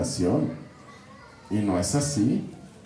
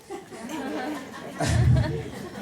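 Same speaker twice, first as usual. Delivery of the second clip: high laugh; amused laughing at own wordplay; 12 semitones higher, 4 dB quieter than usual. Real, not acted. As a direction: burst into a light, high laugh. Chuckles at own antro wordplay.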